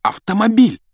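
high-pitched burst of excitement; excited, high exclamation. A narrator's reading. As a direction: exclaim it as a sudden bright idea. Boy excited by an idea.

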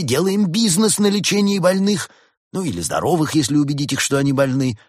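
high, fervent doctor's lecture; eager, self-justifying rabbit doctor; zealous mad doctor. A narrator's reading.

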